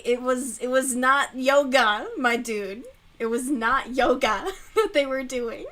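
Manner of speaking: loud, emphatic insistence; indignant. Real, not acted. Insisting to viewers it was not yoga, loud and emphatic. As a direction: drive it home, indignant.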